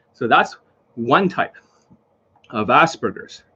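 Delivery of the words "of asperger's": usual speaking voice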